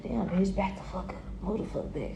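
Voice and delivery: softly voicing a stranger with a hard stare; muttered quote. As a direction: say it under the breath. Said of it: "these people could be like".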